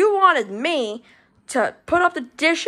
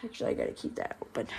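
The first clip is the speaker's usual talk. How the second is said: fast, much softer whisper to self; whispered aside; 14 dB quieter, much faster than usual. Real, not acted. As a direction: whisper it quickly under your breath. Muttered aside while doing chores.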